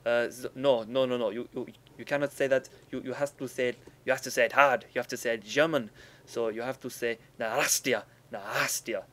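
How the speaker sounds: a hint of a German friend correcting you, forceful; imitating German friend. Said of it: "And he's like".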